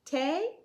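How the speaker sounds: put-on a child offering "Té?" in Spanish; Spanish word. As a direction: ask "Té?" brightly, the Spanish way. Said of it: "said in Spanish".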